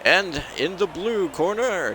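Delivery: high, slow, drawn-out boxing intro; ring announcer. Acted. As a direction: stretch every word like a boxing announcer.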